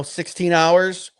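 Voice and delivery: ordinary talk, nothing marked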